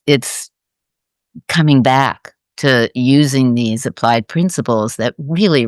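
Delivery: ordinary delivery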